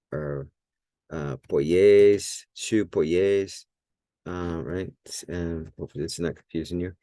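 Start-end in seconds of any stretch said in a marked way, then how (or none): 1.5-3.7 polles drawn out slowly, weight on its final syllable; slow teaching polles, accented at the end; stress on last syllable. Shown as a demonstration. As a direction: stretch and punch the ending of polles. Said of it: "it's always on that last syllable".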